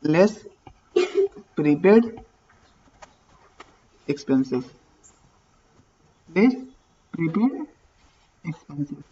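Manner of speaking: low, word by word with long gaps; dictating. Real, not acted. Teacher writing out what gets subtracted, dictating.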